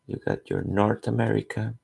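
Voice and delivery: ordinary talk, nothing marked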